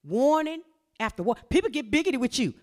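normal talking voice

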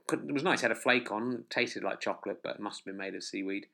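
ordinary delivery

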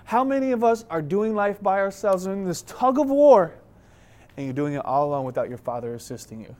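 normal talking voice

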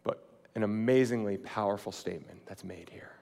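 hushed, awed whisper. Real, not acted. Preacher marvelling at Jesus' words, whispered. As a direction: breathe it like a secret.

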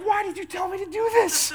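very high, loud comic wail; laughing, exaggerated despair; mock anguish. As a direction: wail the complaint at a friend, hammed up. Retells wailing at buddy over mascara.

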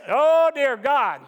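slow, drawn-out whiny "oh dear God"; mock complaining prayer. Preacher imitating how he starts prayer by complaining. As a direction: moan it like a self-pitying complaint. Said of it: "I come in usually and it's".

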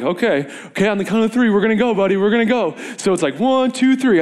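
high encouraging countdown; pumping up. Retold or imitated. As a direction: psych the kid up, building to three. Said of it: "So I'm like".

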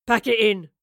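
loud, curt order. Acted. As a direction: call it out to the group. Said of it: "he ordered them".

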